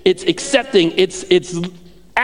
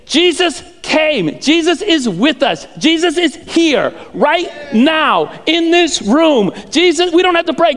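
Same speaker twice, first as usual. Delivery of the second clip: raised pitch, emphatic slow proclamation; full-force preaching; 7 semitones higher, slower than usual. Real, not acted. Preacher telling the room Jesus is here, full-force preaching.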